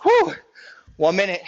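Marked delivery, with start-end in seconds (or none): 0.0-0.3 a high, loud whistling exhale of effort; gasping whew from a workout; sharp high whew. Real, not acted. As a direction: blow out hard after the set. Exhale after hard set.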